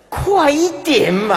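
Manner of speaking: loud, high-pitched mock plea; comic impatient yell. Dying person urging the slow chanters on, comically impatient. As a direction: burst out like someone fed up with waiting.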